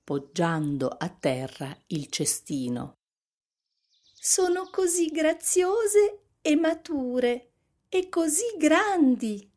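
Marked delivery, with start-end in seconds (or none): 4.1-9.5 put-on high child's voice, full of delight; admiring little girl. A narrator's reading. Little Red admiring blackberries, delighted little girl voice. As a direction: marvel like a small girl.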